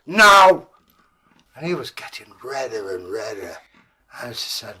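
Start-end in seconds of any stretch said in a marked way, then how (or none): 0.1-0.7 sharp, loud, high no. Acted. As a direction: snap it back. Dwarf angry no.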